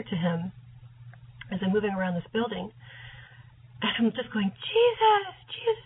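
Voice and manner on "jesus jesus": high, tearful call, much louder; crying out. Retold or imitated. Dreamer calling out to Jesus, tearfully. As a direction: cry out his name with longing. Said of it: "just yelling to him".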